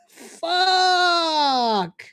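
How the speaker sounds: high, long drawn-out wail; anguished cry. Real, not acted. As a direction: cry out in dismay, stretching the word. Breaks off bit in dismay.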